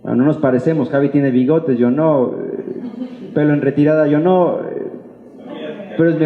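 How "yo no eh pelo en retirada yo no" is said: usual speaking voice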